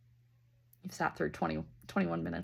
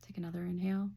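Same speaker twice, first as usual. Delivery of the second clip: guided breathing. Acted. Leads a breathing exercise.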